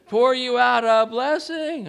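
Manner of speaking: high, loud and rising preacher's call; building sermon climax, calling out to the congregation; fired-up preaching. Acted. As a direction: lift the church with every phrase. Preaching at full force.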